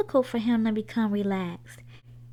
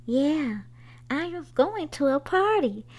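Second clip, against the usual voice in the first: put-on higher, bright child's cheer rising to much higher; 6 semitones higher than usual. A narrator's reading. Boy tells his family he's going to a party, cheering. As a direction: let the joy climb as you go. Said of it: "cheered Henry".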